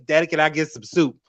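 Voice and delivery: put-on recreate the Southern kid voice from home videos; childhood Southern accent. Shown as a demonstration. Recalling her childhood, a little girl's Southern accent. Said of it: "I had a very strong Southern accent".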